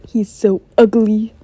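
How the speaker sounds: loud, drawn-out mock dismay; mock disgust. Narrator acts reaction to Jack coming along, mock disgust. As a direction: draw out the insult theatrically. Acted remark on Jack's arrival.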